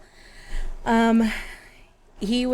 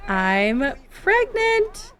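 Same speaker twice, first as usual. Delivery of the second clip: much higher, slow, beaming announcement ending in a shout; voicing thrilled Anne; 10 semitones higher, much slower than usual. Anne telling husband Edward she's pregnant, beaming and much higher. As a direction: Anne bursting with her big news. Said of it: "She's like".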